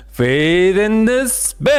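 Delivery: slow, drawn-out ring announcer call; wrestling announcer. Acted. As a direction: boom it out like a wrestling MC. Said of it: "Spoken like a wrestling announcer".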